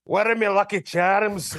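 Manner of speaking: hammed-up Irish accent; Conor McGregor. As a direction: do a cartoonish Irish brogue. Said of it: "is this Connor?".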